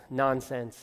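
ordinary talk

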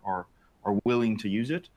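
normal talking voice

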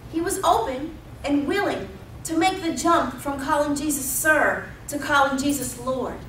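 ordinary delivery

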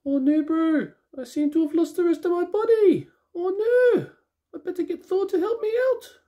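high-pitched, hammed-up bad impression; Korg. Toy reviewer playing a figure who lost his body, bad high-voiced impression. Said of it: "That's my really bad Korg impression".

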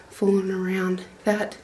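ordinary talk, nothing marked

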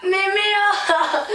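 laughing. Real, not acted. Bursting out laughing at card.

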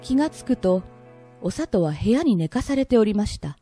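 ordinary delivery

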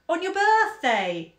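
put-on high, loud, drawn-out childish wail; slow plaintive cry of dismay. A narrator's reading. Daughter appalled at chores on mother's birthday, wailing. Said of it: "Louisa wailed".

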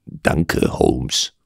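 soft, offhand grumble of thanks; quietly grumbled thanks to Holmes; casual mumble. A narrator's reading. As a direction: toss it off under the breath. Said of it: "brummte ich beiläufig".

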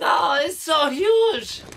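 high wailing cry of no; howling at the screen; anguished shout. Acted. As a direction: wail it out, drawn out and loud. Yelling in-game, mock outrage.

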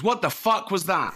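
a sudden startled yell after a loud bang; startled outburst. Retold or imitated. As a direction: blurt it out, jolted by the bang. Startled actor's punchline outburst.

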